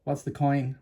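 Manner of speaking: ordinary delivery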